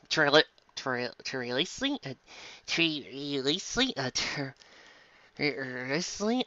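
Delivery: quiet, halting, questioning; puzzled stumble. Real, not acted. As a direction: sound out a strange word. Stumbling over unknown word.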